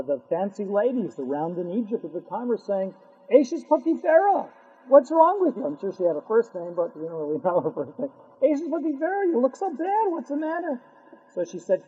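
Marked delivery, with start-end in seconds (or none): 3.3-5.6 high mock-concerned society ladies; gossiping ladies. Gossiping Egyptian ladies voiced. 8.4-10.8 high mock-concerned society ladies; gossiping ladies. Ladies voiced again.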